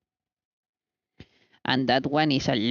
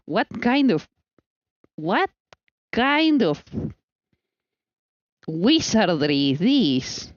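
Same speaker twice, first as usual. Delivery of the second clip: high, then a slow drawn-out ending; playful wonder; mock amazement; 5 semitones higher, slower than usual. Real, not acted. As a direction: marvel at the butter in awe. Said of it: "amazed at butter".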